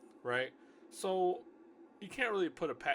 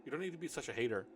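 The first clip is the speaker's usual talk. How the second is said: ordinary delivery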